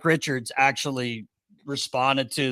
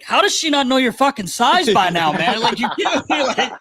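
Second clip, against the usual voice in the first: high, louder, half-laughing disbelief; mock exasperated rant; 11 semitones higher, 4 dB louder than usual. Teasing a teammate about free clothes, mock exasperated. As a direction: play up the outrage at a pile of free clothes.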